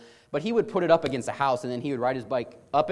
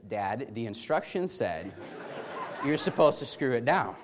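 teasing through laughter; 3 semitones lower, 4 dB quieter, slower than usual. Retold or imitated. Brother teases injured dad.

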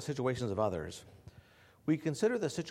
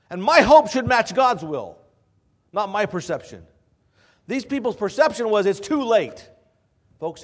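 loud, higher preaching to the church; preaching at full force; 9 semitones higher, 13 dB louder than usual. Acted. Pastor pressing hope on his congregation, full-force preaching. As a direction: drive it home from the pulpit.

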